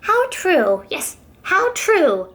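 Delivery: storybook kangaroo coming round; conceding kangaroo. Acted.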